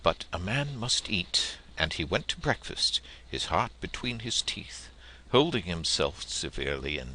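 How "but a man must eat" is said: ordinary delivery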